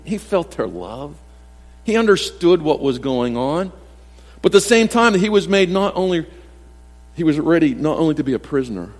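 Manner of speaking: ordinary delivery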